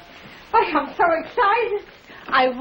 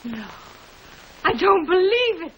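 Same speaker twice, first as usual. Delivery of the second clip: ordinary delivery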